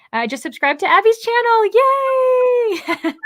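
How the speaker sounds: reading the comment aloud with a squealing high yay; excited cheer. Acted. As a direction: cheer it out like a fan. Cheering while reading a chat comment.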